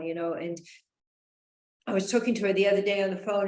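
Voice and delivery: ordinary talk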